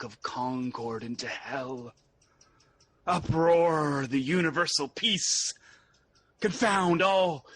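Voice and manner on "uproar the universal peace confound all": louder, building to a roar of defiance; mounting declamation. Acted. Malcolm testing Macduff, declaring he'd wreck peace, louder. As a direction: hurl the words out like a threat.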